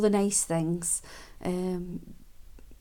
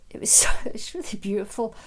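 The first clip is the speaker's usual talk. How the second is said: moved whispered laugh. Real, not acted. Moved while recalling the moment.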